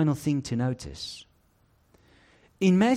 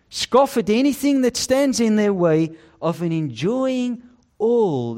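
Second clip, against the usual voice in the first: higher, louder preaching at full force; fiery preaching; 8 semitones higher, 6 dB louder than usual. Acted. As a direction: drive the warning home from the pulpit.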